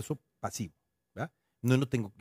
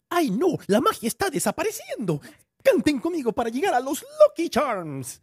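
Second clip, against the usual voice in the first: high, loud cartoon leprechaun voice; Lucky the leprechaun; 9 dB louder than usual. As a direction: squeal it out like a frantic cereal mascot. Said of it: "Haz la voz".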